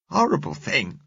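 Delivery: put-on high child's voice, falling at the end; indignant little girl. A narrator's reading. Girl repeats it after triffid is shot, indignant. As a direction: condemn it in a small girl's high voice.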